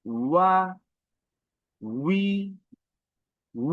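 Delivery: ওয়া, উই, উ each apart, starting with a rounded w glide; pushed w sound. Shown as a demonstration. Teacher drills the vowels, pushing the w. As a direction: push out ওয়া, উই, উ one by one, rising. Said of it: "একটা force নিয়ে বের হচ্ছে".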